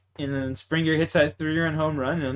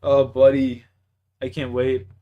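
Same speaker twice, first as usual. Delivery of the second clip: ordinary talk, nothing marked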